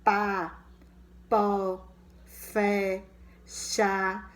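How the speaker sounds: each example word said loud and separate; like a teacher showing examples to the class. Shown as a demonstration. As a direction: set each word apart clearly.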